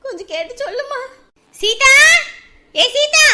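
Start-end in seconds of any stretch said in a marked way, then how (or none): 1.5-1.9 calling out. Acted. Neighbour calling Seetha from outside, loud call. 2.8-3.3 higher, loud insistent call; calling out louder. Acted. Neighbour calling Seetha a second time, louder call. Calls girl again louder.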